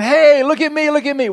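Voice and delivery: high, loud show-off voice for the salt; the salt shouting for attention. Pretend. Preacher voices salt calling to the crowd, high and loud. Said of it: "'cause all that salt saying".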